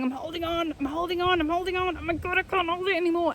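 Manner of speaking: high strained voice, building desperation; straining cry. Acted. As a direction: voice the tree struggling to hold on. Tree voiced straining before splitting.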